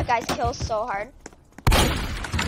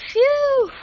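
high relieved phew; 7 dB louder than usual. Real, not acted. Relief after intense fight.

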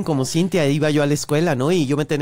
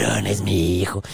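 low sinister voice; 11 semitones lower than usual. Retold or imitated.

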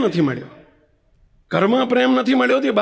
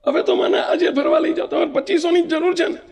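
put-on child's angry demand; 8 semitones higher than usual. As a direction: yell it at your father, hurt and defiant. Son throws piggy bank down, demands.

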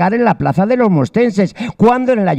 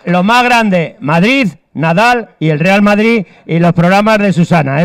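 slow proclamation; slower than usual. Acted. Proclaiming slogan to camera.

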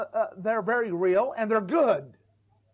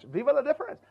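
put-on lightly French-accented Vive la différence; a light French lilt on the phrase; 5 semitones higher than usual. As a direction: say it the French way with flair. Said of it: "like the French".

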